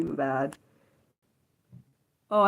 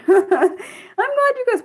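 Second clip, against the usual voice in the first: laughing; 4 dB louder than usual. Real, not acted. Laughs at own scattered brain.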